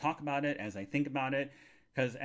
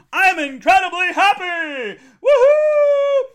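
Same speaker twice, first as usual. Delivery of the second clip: high, loud and slow, then a woohoo; ecstatic shout; 15 dB louder, slower than usual. Real, not acted. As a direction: belt out the happiness. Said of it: "in case you can't tell".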